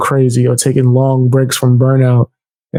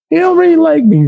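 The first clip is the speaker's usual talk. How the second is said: pitched way up, mocking; high mock voice; faster than usual. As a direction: squeak it up high. Repeats line, high again.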